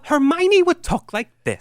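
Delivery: put-on high-pitched girl character voice; Hermione. Shown as a demonstration. Dad showing his bedtime-reading voices to friends, high character voice. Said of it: "I do voices for all the characters".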